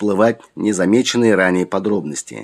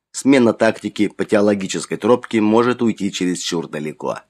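ordinary delivery

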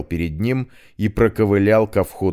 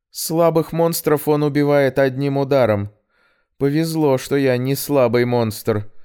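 clumsy joke; 7 semitones higher than usual. Acted. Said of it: "постаралась коряво пошутить".

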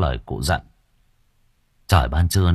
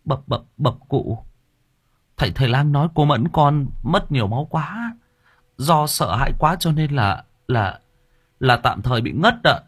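hesitant; 6 semitones higher than usual. Acted. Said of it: "ngập ngừng".

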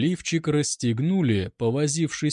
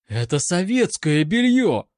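raised, loud indignant outburst; acted roar, more high than huge; 6 semitones higher, 5 dB louder than usual. A narrator's reading. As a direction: roar it in protest, pitched up. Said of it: "взревела Анка".

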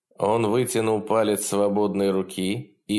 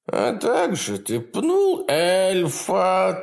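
high, gleeful imp voice; cackling hell torturer; 11 semitones higher, 3 dB louder than usual. Acted. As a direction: taunt him with cruel glee. Demon taunts.

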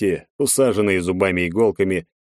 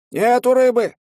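put-on old man shouting; 5 dB louder than usual. A narrator's reading. Old man repeats no fish.

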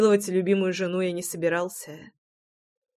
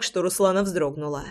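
ordinary delivery, nothing marked

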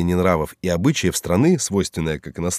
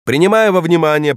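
high, raised formal proclamation; stern judge; 9 semitones higher, 5 dB louder than usual. Acted. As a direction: proclaim it from the bench. Judge repeats the formula. (торжественный голос судьи)

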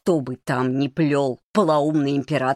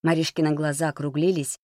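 plain reading voice

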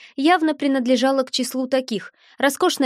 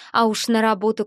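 ordinary delivery

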